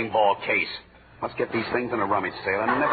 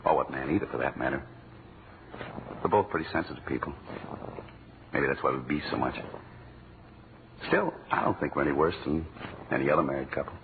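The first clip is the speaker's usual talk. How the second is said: low, soft, sincere confession; tender sincere; 8 semitones lower, 5 dB quieter than usual. Acted. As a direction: speak softly from the heart.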